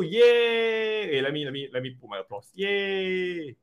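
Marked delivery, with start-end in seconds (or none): 0.0-1.1 high, drawn-out yay; celebratory cheer. Pretend. Cheering for the first-prize winner. 2.5-3.6 soft, high drawn-out yay; a small, long mock crowd cheer; celebratory cheer. Putting in applause for the winner.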